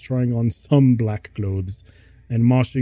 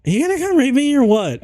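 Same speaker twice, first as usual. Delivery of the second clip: very high, fast indignant squeal; shrieky put-on teen girl voice; high yell; 13 semitones higher, 3 dB louder, faster than usual. A narrator's reading. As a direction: yelp it out in a rush, way up high. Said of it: "I yelled".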